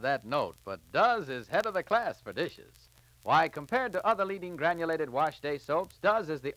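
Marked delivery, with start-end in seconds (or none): none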